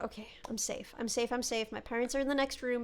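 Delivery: low, soft muttering to oneself; hushed self-reassurance. Acted. Kid in bed telling himself he is safe, hushed. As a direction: murmur it like a scared kid self-soothing.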